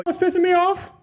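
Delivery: hammed-up annoyed question; mock indignant. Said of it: "you can go".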